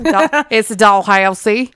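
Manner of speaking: loud, slow, laughing "Dalhousie"; overdone, drawn-out attempt at "Dalhousie"; exaggerated pronunciation. Shown as a demonstration. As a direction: blare out "Dalhousie" with the middle stretched. Mocking own trouble saying the name.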